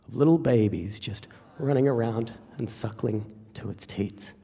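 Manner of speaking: low, soft narration with long pauses; hushed storytelling. Acted. Describing the sleeping pig, in a low storyteller's hush.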